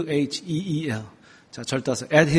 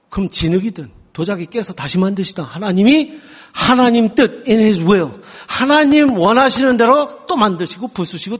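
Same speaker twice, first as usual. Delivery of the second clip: loud, rising preacher's exhortation; fervent preaching; 6 semitones higher, 5 dB louder than usual. Acted. Pastor urging the congregation to endure, loud fervent preaching. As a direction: urge them on with full force.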